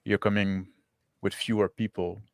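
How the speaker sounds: usual speaking voice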